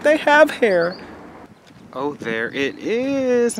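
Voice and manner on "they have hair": high, loud silly voice for the animals; mock squeaky voice. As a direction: squeak it out like a cartoon critter. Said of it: "Be like".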